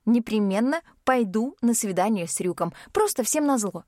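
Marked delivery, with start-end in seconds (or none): none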